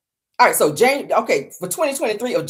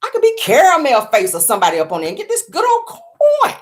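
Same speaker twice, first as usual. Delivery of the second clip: excited scheming; 10 semitones higher, 3 dB louder than usual. Retold or imitated. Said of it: "I said".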